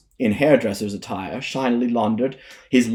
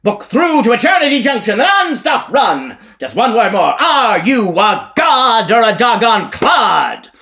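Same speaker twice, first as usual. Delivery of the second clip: high, loud evangelist hollering at the crowd; revivalist preacher; 12 semitones higher, 8 dB louder than usual. Acted. Elijah harangues the whorehouse crowd like a preacher. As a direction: bark like a tent-show preacher selling salvation. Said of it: "Elijah's voice, harsh as a corn crake's".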